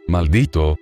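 usual speaking voice

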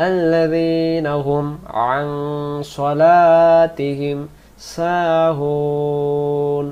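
slow, drawn-out tajweed chant; Quran recitation. Acted. Teacher reciting the verse slowly for students. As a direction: hold সাহুন very long.

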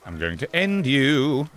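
slow, drawn-out playful threat; teasing slow threat to a game enemy; slow mock menace. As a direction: savour each word like a cartoon villain.

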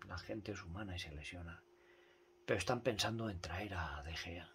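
whisper. Real, not acted. Hushed before outburst.

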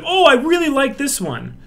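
high, bright approving voice; mock gushing. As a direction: gush like a fan. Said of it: "Everyone's like".

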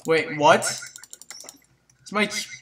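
ordinary talk, nothing marked

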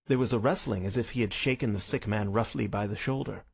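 ordinary delivery, nothing marked

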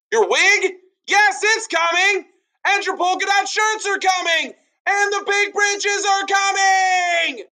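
very high, loud bullhorn shout; high shouting Paul. Acted. Noisy Paul shouting to a customer, very high and loud.

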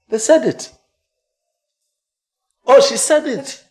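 high, loud, drawn-out exclamation; indignant realisation. Acted. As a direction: exclaim it loudly, stung. Son realising mother was right.

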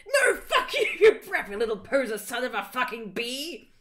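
high, loud mock-angry rant; hammed-up insult, half laughing; disgusted outburst. A narrator's reading. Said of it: "in a disgusted way".

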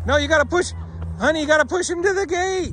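high, loud coaching call. Real, not acted. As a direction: urge them on from across the field. Urging child to push cow.

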